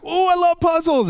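put-on very high, loud child voice; over-the-top excited kid; eager little son. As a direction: squeal with delight like a small boy. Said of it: "And son was like".

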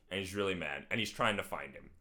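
ordinary talk, nothing marked

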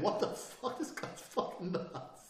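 breathy, unsettled mock reaction; slow, airy bewilderment, falling away; stunned disbelief. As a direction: imitate a freaked-out onlooker. Onlookers' stunned thought voiced.

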